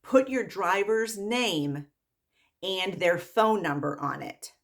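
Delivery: slow, carefully spaced words; deliberate, measured instruction; receptionist's instructions. Retold or imitated. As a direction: spell it out slowly, phrase by phrase. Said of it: "and she says to them".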